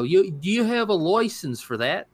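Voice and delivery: put-on a clumsy stab at a British accent; bad British accent. Mocking British officials to his co-host, bad British accent. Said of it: "I can't do accents".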